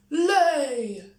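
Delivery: loud, high order; command. A narrator's reading. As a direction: bark it at the hen. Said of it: "commanded the giant".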